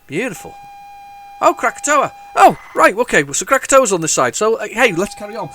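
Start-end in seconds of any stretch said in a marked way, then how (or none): none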